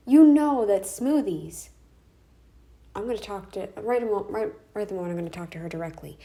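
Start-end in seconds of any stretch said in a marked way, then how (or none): 0.1-1.7 louder, slow rant. Real, not acted. Ranting at critic about smoothies.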